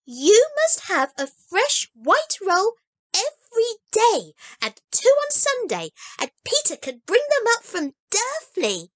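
put-on high, bright child voice, earnest; a small girl promising with full conviction; eager little girl Heidi. A narrator's reading. Heidi plans the rolls.

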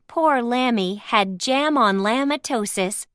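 usual speaking voice

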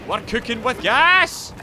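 loud, high celebratory yell building to the last word; excited rising holler; triumphant shout. Real, not acted. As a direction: crow it mid-victory.

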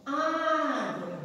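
Água with Á high and held; stress on first syllable. Shown as a demonstration. Teacher showing the accent on A, Á drawn out. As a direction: stretch the open "Á" of "Água". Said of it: "no A".

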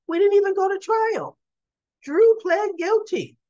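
high, loud insisting; emphatic retort. Retold or imitated. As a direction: drive it home, exasperated. Diane insists to Carly.

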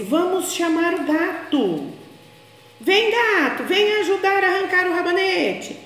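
eager puppy calling for help; Totó the dog calling the cat, high voice; dog talking. Acted. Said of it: "o Totó disse".

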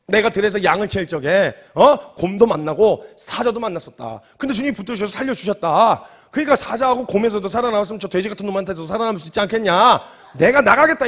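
loud, insistent pleading; making a commotion. Acted. David arguing to be sent out to fight, worked-up insistence. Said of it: "이러면서 막 난리를 친 거예요".

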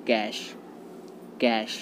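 कैश as one syllable ending in sh; कैश with open e and soft sh; correct pronunciation. Shown as a demonstration. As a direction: say कैश like English 'cash'. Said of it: "right pronunciation जो होता है".

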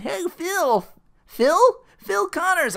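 very high, loud hailing voice; over-eager acquaintance. Retold or imitated. Ned hailing Phil on the street, high and over-eager. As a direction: hail someone in a hammed-up high voice.

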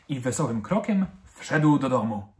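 ordinary delivery

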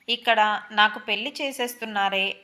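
plain reading voice

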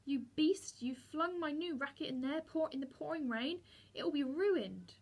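high, indignant accusation; horrified anger. A narrator's reading. Boy seeing his new racket soaked, horrified and angry. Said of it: "in horror and anger".

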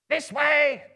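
high, loud goblin yell. A narrator's reading. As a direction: yell to the others, pointing the way. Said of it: "some yelled".

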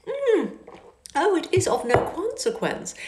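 high, airy dismissal; high affected lady. A narrator's reading. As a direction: wave it away with a lilt. Miss Bingley brushing off.

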